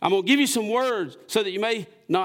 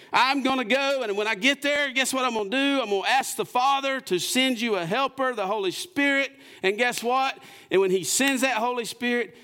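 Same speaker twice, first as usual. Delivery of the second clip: lightly raised, earnest voice of Jesus; voicing Jesus; 8 semitones higher than usual. Preacher voicing Jesus promising the Helper, a touch raised. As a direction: promise it like Jesus to his disciples.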